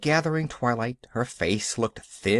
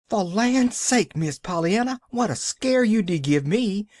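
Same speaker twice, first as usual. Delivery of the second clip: panting fright; 10 semitones higher, 3 dB louder than usual. Acted. Nancy runs up panting, scolding Pollyanna for the scare. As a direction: pant it out after a scare. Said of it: "panted Nancy".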